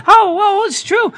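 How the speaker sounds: high, loud snippy retort; a hammed-up high retort; mock indignant. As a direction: snap back, very high. Acted quarrel between friends.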